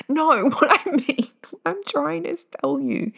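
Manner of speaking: high, laughing through the words. Real, not acted. Laughing at her own slip to listeners. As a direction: giggle it out.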